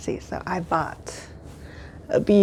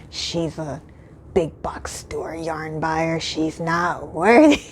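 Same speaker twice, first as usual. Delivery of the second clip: lightly voiced catty whispering clerks; hiss-free gossiping whisper, half-joking; mock snobby whisper; 3 semitones lower, slower than usual. Imagined snobby shop staff.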